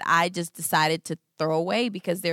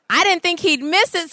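usual speaking voice

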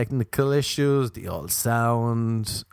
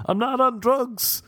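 mock shout; 11 semitones higher, slower than usual. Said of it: "me shouting".

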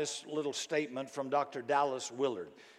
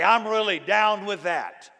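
loud, higher-pitched hearty agreement; emphatic millennial approval; 4 semitones higher, 7 dB louder than usual. Acted. Preacher giving the millennial version of amen, loud and emphatic. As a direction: voice an enthusiastic millennial yes. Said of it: "Or millennials would say".

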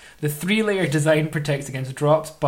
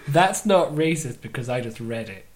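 ordinary talk, nothing marked

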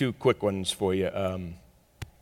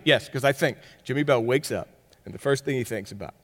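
ordinary talk, nothing marked

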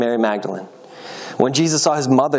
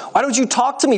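high, exasperated demand for an answer; 12 semitones higher than usual. A narrator's reading. Pilate questions a silent prisoner, demanding. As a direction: demand that he speak to you. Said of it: "Pilate demanded".

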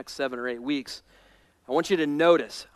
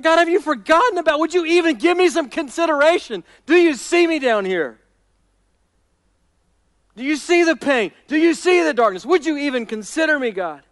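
urgent, raised-pitch questioning of God; pleading complaint; 12 semitones higher, 8 dB louder than usual. Acted. David asking if God has forgotten him, urgently pleading. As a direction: press each question like an acted plea.

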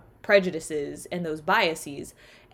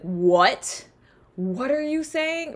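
mock puzzled question, pitched higher; baffled listener; 6 semitones higher, slower than usual. Baffled listener questioning the speaker's odd phrase, mock confusion. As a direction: act out someone not understanding.

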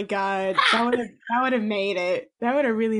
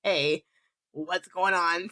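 low, soft mock guard; 7 semitones lower, 4 dB quieter, much slower than usual. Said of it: "even the guards were like".

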